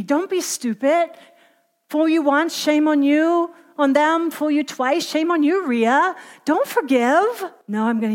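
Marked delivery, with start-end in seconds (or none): 0.0-1.1 higher-pitched, voiced goading. The enemy talking Rhea out of forgiving, goading. 1.9-7.5 higher, drawn-out taunt. Acted. The enemy urging Rhea not to forgive, taunting.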